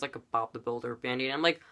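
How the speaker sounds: plain reading voice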